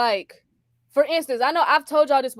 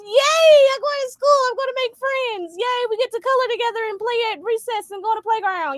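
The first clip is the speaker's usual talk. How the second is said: put-on excited child; 9 semitones higher than usual. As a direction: play the eager kindergartner. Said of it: "I was so excited".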